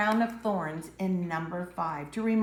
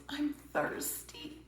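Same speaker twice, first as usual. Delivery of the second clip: slow, soft whispered plea; barely able to speak; weak whisper; 6 semitones higher, 10 dB quieter, slower than usual. Acted. Dying Jesus on the cross, slow weak whisper.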